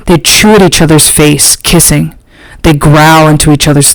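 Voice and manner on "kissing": ordinary delivery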